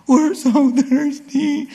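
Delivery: high, slow whining; the preacher mocks complaining Israelites, high and whiny; whiny complaint. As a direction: whine it like a thirsty child. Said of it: "They complain with the same exact language".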